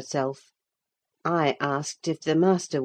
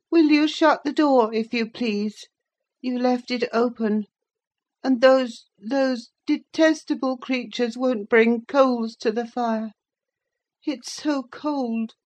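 put-on higher, complaining sickly boy fading at the end; whining invalid; 8 semitones higher than usual. A narrator's reading. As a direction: grumble about the cold, petulant and weak. Linton complains of cold.

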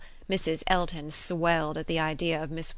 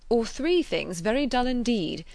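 raised, measured announcement of the rules; announcer's delivery; 6 semitones higher, 5 dB louder than usual. Acted. Frank listing options to the party, announcer's delivery. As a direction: set it out as the last option. Frank's take, later echoed.

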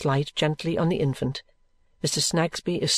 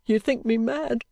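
tearful mother; 10 semitones higher, slower than usual. Acted. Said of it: "her eyes filling with tears".

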